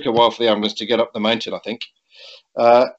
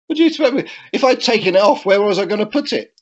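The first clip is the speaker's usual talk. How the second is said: exasperated retort; 9 semitones higher than usual. Retold or imitated. Retorting to his wife's remark.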